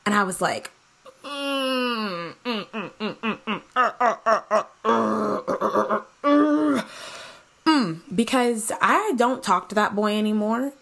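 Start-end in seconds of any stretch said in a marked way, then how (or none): 1.2-7.9 strained awkward humming and stammering; nervous, half-laughing stammer; stalling hums. Retold or imitated. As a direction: stall helplessly with hums and uhs. Awkward stalling, can't remember him.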